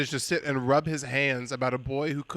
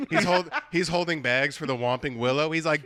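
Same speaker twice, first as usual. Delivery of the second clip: ordinary talk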